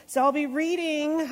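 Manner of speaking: usual speaking voice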